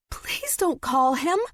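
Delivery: high, slow plea; horrified begging. A narrator's reading. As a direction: beg in horror. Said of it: "Stevie begged, horrified".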